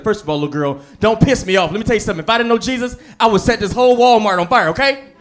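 loud, high angry mom threat; mom threatening. Retold or imitated. Mom scolding her daughter in Walmart, loud and high. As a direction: menace like a furious church mom. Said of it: "She was like".

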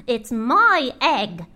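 slow, indignant bird voice; mildly sputtered protest, drawn out; sputtering. Acted. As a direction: lean on each word in outrage. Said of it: "she sputtered".

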